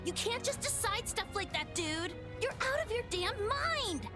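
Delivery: high-pitched indignant protest; outraged retort. Acted. Companion telling the villain he is mad, outraged.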